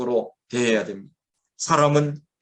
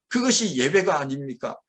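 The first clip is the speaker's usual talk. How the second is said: ordinary talk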